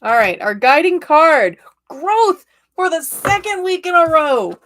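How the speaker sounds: loud, high and bright with excitement; thrilled reveal; excited announcement. Real, not acted. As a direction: proclaim the card like big news. Excited card reading announcement.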